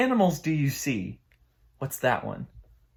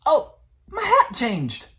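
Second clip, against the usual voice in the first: mock surprise; 12 semitones higher, 5 dB louder, slower than usual. Pretend surprise at hat.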